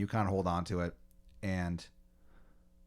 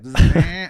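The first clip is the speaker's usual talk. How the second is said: imitated giant fly buzz; high, very loud insect buzz; loud buzzing noise; 8 semitones higher, 13 dB louder than usual. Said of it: "noise, and it's so fucking loud".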